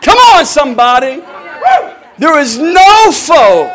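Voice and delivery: high, loud preaching shout; shouting praise. Real, not acted. Preacher shouting to the congregation that God is a mighty warrior. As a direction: proclaim it with full fire.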